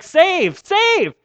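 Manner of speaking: high, loud chanting shouts of save; children's shouted cheer. Retold or imitated. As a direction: cheer it like excited kids in the temple. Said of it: "they're like".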